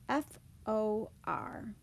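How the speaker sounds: "F O R" letter by letter, slowly; spelled out slowly. Shown as a demonstration. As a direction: leave clear gaps between the letters of "F O R". Teacher spells out the word.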